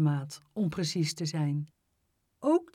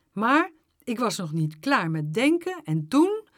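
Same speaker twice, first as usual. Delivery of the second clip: ordinary delivery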